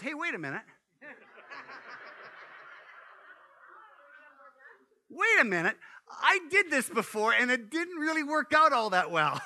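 the dog catching on to her mistake; dog's inner voice. Pretend. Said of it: "that she goes".

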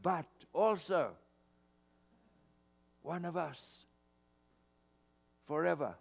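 ordinary talk, nothing marked

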